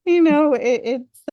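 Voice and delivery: high, loud words through a laugh. Real, not acted. Speaker laughing with the host at the advice, laughing. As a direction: laugh it out in disbelief.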